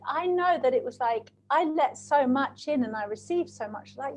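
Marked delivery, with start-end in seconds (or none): none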